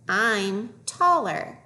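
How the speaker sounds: very slow, stretched words; slow reading model. Shown as a demonstration. Teacher modelling a phrase for young readers, slowly. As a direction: draw each word out for learners.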